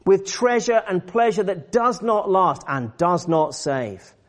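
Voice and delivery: high, forceful preaching; urgent exhortation. Real, not acted. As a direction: press each command on the congregation. Preacher voices Paul's urgent command.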